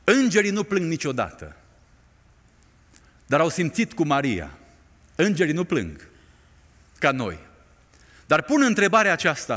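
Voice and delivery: ordinary delivery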